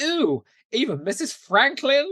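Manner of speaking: high, loud disgusted squeal; Cordelia's squeamish disgust. Retold or imitated. Cordelia reacting to Giles's underwear pep talk, high squeal. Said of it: "And she goes".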